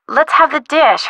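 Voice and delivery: normal talking voice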